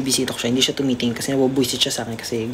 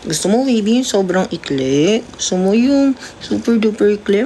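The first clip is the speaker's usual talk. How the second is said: playful teasing; 8 semitones higher, 4 dB louder than usual. Real, not acted. Teasing cat about haircut.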